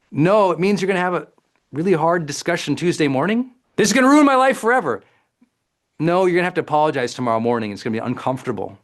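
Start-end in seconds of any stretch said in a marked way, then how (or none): 3.8-5.0 higher, louder and fast mock panic; hammed-up melodrama, even bigger; over-the-top catastrophizing. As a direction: blurt it out in a rush of doom. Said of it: "we use dramatic language".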